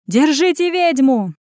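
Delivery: high, loud, drawn-out shout. A narrator's reading. Pursuers chase Anya through the alleys, shouting. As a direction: call out to catch the fugitive.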